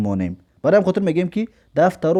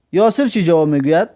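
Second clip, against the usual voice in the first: ordinary delivery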